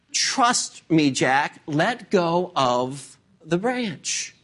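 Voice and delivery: slow, calm instruction from above; measured divine voice, drawn out at the end; voice of God. Acted. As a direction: reassure as God, deliberately and unhurried. Acting God calmly reassuring Jack.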